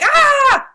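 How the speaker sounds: put-on high, loud startled cry of a little kid; kid's startled "ah". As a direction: yelp "Ah!" like a scared boy. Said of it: "I was like".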